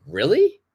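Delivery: "Really?" leaping high at the end; a surprised "Really?" swooping sharply up; rising intonation. Shown as a demonstration. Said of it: "intonation is important".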